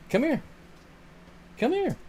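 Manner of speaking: high, soft you're so needy cooed at the dog. Real, not acted. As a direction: coo at the needy puppy. Calling pet.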